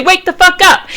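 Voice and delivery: shouting at yourself in the mirror; pretend wake-up yell. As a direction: bark it at your own reflection. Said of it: "shake the shit out of myself and say".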